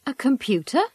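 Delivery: "A computer?" with pitch rising on computer; rising question intonation. Acted. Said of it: "Notice the interviewer's rising intonation on the question".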